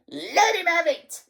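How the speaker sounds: high screech breaking into a cackle; gleeful, high-pitched screeching; shrieking old harridan. A narrator's reading. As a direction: egg on the punisher in a shriek. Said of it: "she was shrieking".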